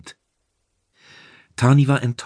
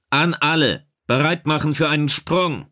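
high, projected command to all hands; loudspeaker announcement; 6 semitones higher than usual. A narrator's reading. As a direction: announce it to the whole crew. Commander announces over ship's loudspeakers.

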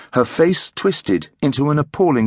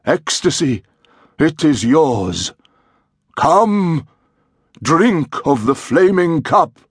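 high, slow, exalted priest intoning the rite; ecstatic high priest; 5 semitones higher, slower than usual. A narrator's reading. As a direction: chant it like a priest offering the sacred cup. Priest's ritual incantation.

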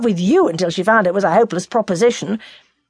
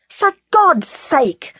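drawn-out, higher, furious plea; violent outburst; 9 semitones higher, much slower than usual. A narrator's reading. Son to father, violently. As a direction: fling the words out in disgust. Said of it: "said Henry violently".

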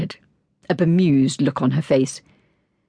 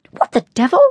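indignant question; 6 semitones higher, 5 dB louder, faster than usual. A narrator's reading. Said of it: "Vicky mumbled as she came towards Millie".

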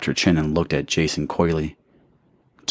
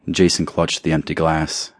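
ordinary delivery

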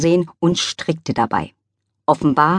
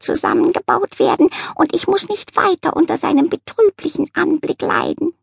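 a prim cat overcome by gallantry; cat fawning over the dog's chivalry; swooning cat; 12 semitones higher than usual. Acted. Cat admires the dog.

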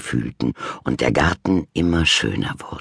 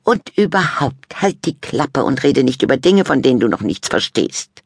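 put-on high, louder bossy teen girl; snippy sister; 11 semitones higher, 4 dB louder than usual. A narrator's reading. Sister telling brother to shut up, high voice. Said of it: "fügte sie hinzu".

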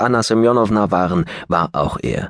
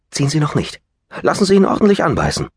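much higher and fast; excited urging; 7 semitones higher, faster than usual. Acted. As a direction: hurry her, thrilled by the bite. Fishing advice mid-declaration.

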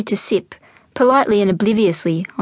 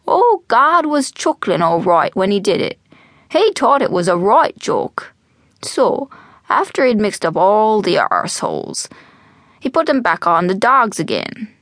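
put-on animated old man's brogue, pitch swooping; old Irish storyteller; 2 semitones higher than usual. Old man to eager young Jack, storytelling brogue. Albert tells his joke.

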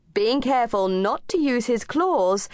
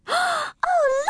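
high excited oh; a child suddenly spotting someone; surprised gasp. A narrator's reading. Amy gasps seeing Miss Summers.

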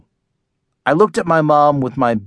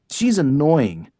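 usual speaking voice

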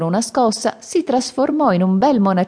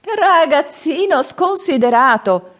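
put-on laughing old man; 12 semitones higher, 4 dB louder, slower than usual. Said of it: "rise il vecchio".

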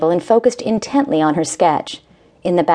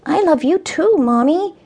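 put-on tiny, high, muffled child; little boy voice; 10 semitones higher than usual. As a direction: say it muffled against her. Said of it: "his tiny muffled voice returned".

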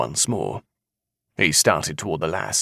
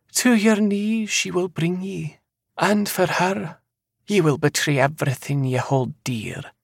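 eerie seer; 12 semitones higher than usual. Acted. Seer foretelling a man's future, high and eerily certain.

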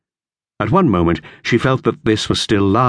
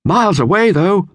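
lookout call; 13 semitones higher, 3 dB louder than usual. A narrator's reading. Lookout adds to her call.

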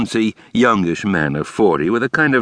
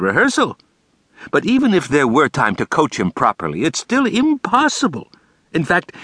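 higher, fussy theatrical principal; Brooks's voice; 8 semitones higher than usual. A narrator's reading. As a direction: lay it out with flair.

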